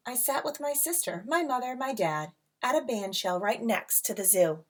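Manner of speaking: lilting read-aloud storybook voice; rhyming picture book read to a class; reading to children. A narrator's reading.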